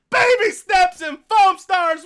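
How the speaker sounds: mock a delusional fanboy; sarcastic slow sneer. Mocking a PlayStation fan.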